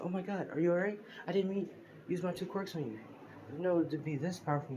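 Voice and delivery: high-pitched, mildly acted distress; lightly voiced tearful apology; near tears. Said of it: "She's about to cry".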